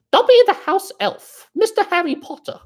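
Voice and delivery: very high squeaky elf voice; high-pitched elf impression; Dobby the House Elf. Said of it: "was that a good Dolby imitation".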